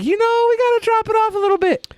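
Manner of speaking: squeaky, fast mock company voice; high mock voice. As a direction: toss it off in a silly high voice. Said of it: "They're like".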